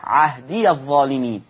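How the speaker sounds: "عهدي" said with its ya opened as "عهديَ"; slow recitation joining "عهدي الظالمين". Shown as a demonstration. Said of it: "أفتح". (فتح ياء "عهدي" في الوصل عند ورش)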